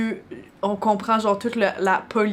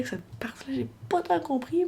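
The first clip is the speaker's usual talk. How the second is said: whispering; 6 semitones higher, 5 dB quieter than usual. Real, not acted. Confiding aside.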